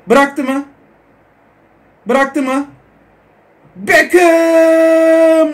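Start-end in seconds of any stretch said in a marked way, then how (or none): 3.8-5.5 a loud drawn-out shout of the name; excited shout. Real, not acted. Gamer watching his last-minute free kick, loud excited shout. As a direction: cry out as the kick flies.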